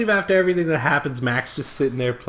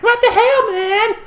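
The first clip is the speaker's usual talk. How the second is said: high mocking girl voice; 6 dB louder, slower than usual. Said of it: "Daisy's like".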